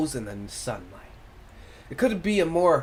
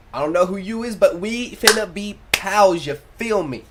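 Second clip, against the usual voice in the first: friendly hype voice; 6 semitones higher, 6 dB louder than usual. Acted. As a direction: win him over as a new pal. Character voice, friendly slang.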